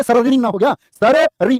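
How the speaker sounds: hammed-up wrong सररी; mock wrong pronunciation. Shown as a demonstration. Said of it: "तो सररी क्यों करोगे".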